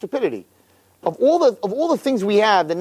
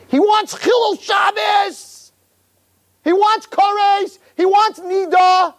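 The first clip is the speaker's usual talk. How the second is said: full-force preaching; 10 semitones higher, slower than usual. Real, not acted. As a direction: hammer each phrase out at the top of the voice.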